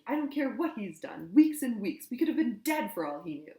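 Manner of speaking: loud raging protest; furious shouting. A narrator's reading. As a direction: rage on without stopping. Hermione keeps screaming.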